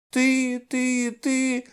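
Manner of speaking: put-on high, thin little-girl mumble, drawn out; faint childish babble. A narrator's reading. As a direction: babble it through barely parted lips. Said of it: "пролепетала она, едва разжимая губы".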